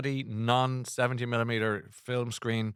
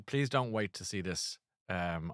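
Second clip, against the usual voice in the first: ordinary talk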